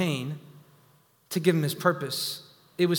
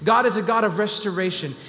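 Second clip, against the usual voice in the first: higher and louder, rising fervor; building preaching; 6 semitones higher, 4 dB louder than usual. Acted. Preacher driving the congregation toward the climax, rising and loud. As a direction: preach it up, climbing with every line.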